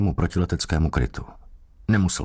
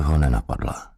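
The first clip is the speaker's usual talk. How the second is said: usual speaking voice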